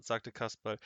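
ordinary delivery

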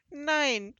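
high, loud pleading cry; desperate begging. A narrator's reading. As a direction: cry out in dread. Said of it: "flehte Hotzenplotz".

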